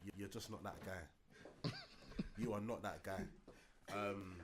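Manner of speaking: low, slow, deliberate delivery; measured verdict, softly spaced out; Donald Trump. Real, not acted. Podcaster passing judgment on a wrestler, slow Trump-like delivery. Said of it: "sound like he's Donald Trump".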